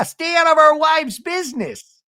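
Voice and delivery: high, loud mock shout. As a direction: holler it like an indignant husband. Acting out husbands' warning.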